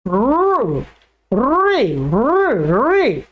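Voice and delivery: रे as a long rolled r swelling up and falling; correct trilled vocalic r. Shown as a demonstration. Teacher showing the correct pronunciation, trilled r. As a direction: roll the tongue through रे, barely any vowel. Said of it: "इसका उच्चारण कैसे करना है".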